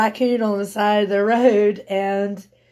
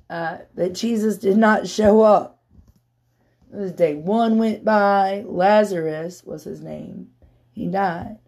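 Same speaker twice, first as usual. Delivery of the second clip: ordinary talk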